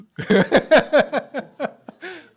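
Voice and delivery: a high, loud laugh at himself; laughing out loud at his own quiz result; burst of laughter. Real, not acted. Pastor laughing at his flawless score before the congregation, a high, loud laugh. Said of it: "laughs at own result".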